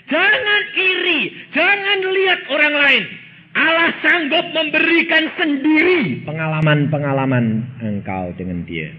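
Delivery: high, loud, slow exhortation; preaching at full force. Acted. Preacher exhorting the congregation not to envy, full force. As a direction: press the warning onto the crowd.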